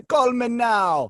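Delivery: slow, drawn-out Jamaican "call me now"; lightly imitated Jamaican accent, slow; Miss Cleo drawn out. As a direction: stretch it like a late-night psychic ad. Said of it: "her accent would slip".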